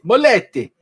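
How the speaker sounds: hammed-up Italian accent. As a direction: belt Moletti like an excited Italian. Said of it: "Don't know why I sound Italian".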